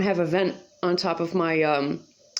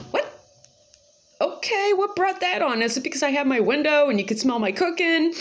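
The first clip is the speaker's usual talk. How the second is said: high, incredulous question; higher, puzzled retort; surprised; 8 semitones higher than usual. Retold or imitated. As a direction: react in baffled disbelief. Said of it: "I'm like".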